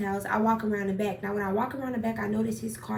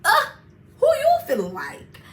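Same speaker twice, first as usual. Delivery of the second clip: mocking chuckle; 12 semitones higher, 8 dB louder than usual. Best friend mocking her.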